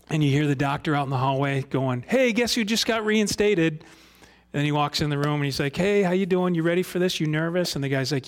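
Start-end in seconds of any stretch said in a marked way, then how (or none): 2.1-3.8 high, bright mock call of a cocky doctor; doctor calling out to the hallway, cheerfully; cheery doctor. 5.8-7.7 doctor greeting the nervous patient, chummy; breezy doctor. Retold or imitated.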